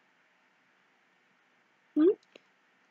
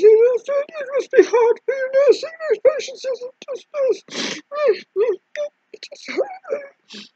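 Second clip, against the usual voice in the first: high, cooing pity; mock sympathy; 13 semitones higher than usual. Mimicking a sympathetic friend, cooing and high. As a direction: coo it like a soothing friend. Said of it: "If anybody showed any sympathy".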